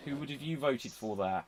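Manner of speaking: whispered aside. Real, not acted. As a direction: whisper the question.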